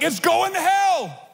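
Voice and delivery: slow, high mock shout of despair; mock panic. Congregation hears mock panic, high slow shout. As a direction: cry out in hammed-up alarm.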